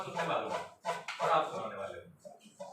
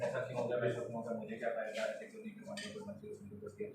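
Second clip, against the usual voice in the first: hushed, half-whispered inner reasoning; logical brain's voice; 7 semitones lower, 8 dB quieter than usual. Retold or imitated. Teacher voices the left brain's reasoning, hushed. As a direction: whisper the brain's nagging questions. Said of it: "reasoning".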